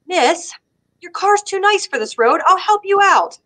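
a hint of a courteous stranger; kind stranger. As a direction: offer help like a polite local. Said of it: "and he's like".

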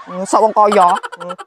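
ordinary talk

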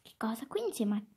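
ordinary talk, nothing marked

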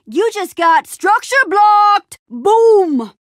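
high, loud, slow victory taunt; gloating shout. Acted. Taunting the Heckmouth over the structure block, gloating shout. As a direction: stretch it out loud, then punch "Boom".

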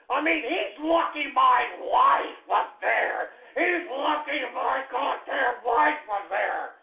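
slow, emphatic angry delivery; slow, forceful raging; seething threat. Real, not acted. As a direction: drag out each word in anger. Threatening rant, slowed for force.

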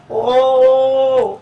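long, loud "oh"; drawn-out loud moan; protesting wail. Acted. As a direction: wail in complaint. Protesting wail about stressful cooking.

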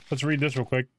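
normal talking voice

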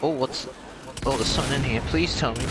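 normal talking voice

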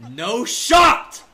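high loud disbelieving yell; real frightened outburst; scared yell. Real, not acted. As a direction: yell it in shock. Sees monster, yells.

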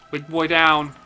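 big shouted call; loud cheer. Real, not acted. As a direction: shout it out in triumph.

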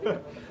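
soft, high chuckle; laughing. Real, not acted. Reacting to a flirty joke from a colleague, laughing. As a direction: giggle lightly at the joke.

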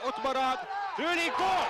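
high, loud goal call; goal shout. Real, not acted. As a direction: scream the goal out. Commentator calls the winning goal.

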